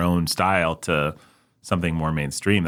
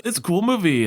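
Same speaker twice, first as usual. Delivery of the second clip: high-pitched mock enthusiasm; put-on high, easygoing praise; mimicked fan; 11 semitones higher than usual. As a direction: imitate a friend gushing, pitched way up. Mimics friend Ben praising the film.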